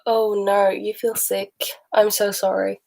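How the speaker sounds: ordinary delivery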